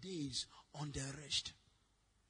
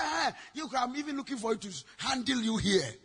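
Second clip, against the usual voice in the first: retold scolding; 2 semitones higher, 7 dB louder than usual. Preacher's retold scolding on phone.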